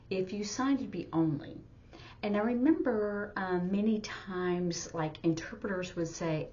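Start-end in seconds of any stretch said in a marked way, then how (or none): none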